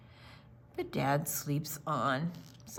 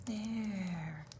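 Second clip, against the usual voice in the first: soft bedtime whisper; 9 dB quieter than usual. A narrator's reading. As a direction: hush it like a sleeping child. Bedtime story ending, hushed.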